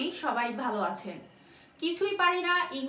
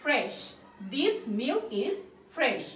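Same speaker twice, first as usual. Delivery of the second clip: very slow, each word separated; slow model sentence; much slower than usual. Shown as a demonstration. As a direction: read it out slowly for the class. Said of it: "তাহলে কিভাবে বলবো".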